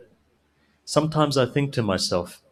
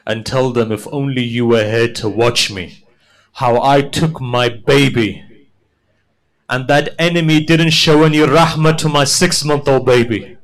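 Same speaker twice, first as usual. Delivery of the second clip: mournful preaching; 6 dB louder than usual. Acted. Imam telling Minhal his grief, building lament.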